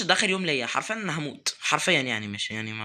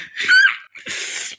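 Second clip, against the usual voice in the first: laugh; 7 dB louder than usual. Real, not acted. Nervous burst after escaping.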